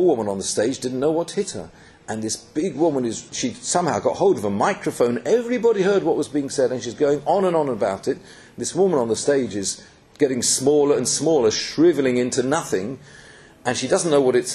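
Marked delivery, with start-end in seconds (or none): none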